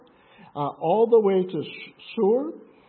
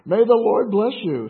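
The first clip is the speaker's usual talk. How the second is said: bright, louder blessing as a greeting; cheerful greeting; 4 semitones higher, 7 dB louder than usual. A narrator's reading. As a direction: greet him gladly and loudly. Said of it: "Saul greeted him cheerfully".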